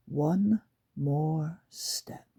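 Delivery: slow breathy whisper under the breath; whispered thought. Acted. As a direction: hold it in, barely a whisper. Lucy's secret thought before trap.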